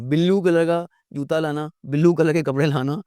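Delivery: ब्लू split into "billu"; imitated mispronunciation. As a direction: mimic learners saying ब्लू as "bil-loo". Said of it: "ब्लू नहीं यार". (ब्लू को "बिल्लू" बोलने की नकल)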